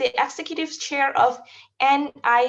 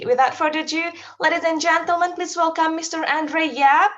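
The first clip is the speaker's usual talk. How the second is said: louder, lifted announcer welcome to the audience; emcee introduction; 3 semitones higher, 4 dB louder than usual. Real, not acted. Host announcing the keynote speaker to the audience, in a raised emcee voice. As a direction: build up the guest like an event host.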